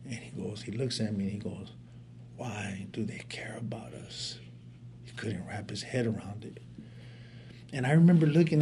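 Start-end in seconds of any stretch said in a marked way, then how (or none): 2.4-4.4 hushed, slow, near-whispered question; imitating tearful brother. Crying younger brother asks his older brother, tearful near-whisper. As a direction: quote a sobbing brother in a faint, halting hush. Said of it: "he's sobbing uncontrollably".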